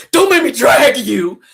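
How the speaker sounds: slow, loud, high threat; shouted warning. Acted. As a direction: holler a drawn-out warning. Mock threat to the crier.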